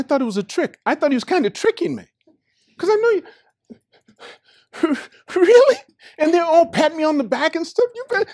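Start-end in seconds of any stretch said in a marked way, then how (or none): 2.8-8.3 high, loud, overwhelmed telling; overcome. Real, not acted. Recalling the moment he finally passed, overcome and high. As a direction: voice lifted high with emotion. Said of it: "I started crying".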